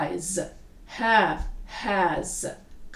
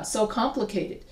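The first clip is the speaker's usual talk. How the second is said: ordinary talk, nothing marked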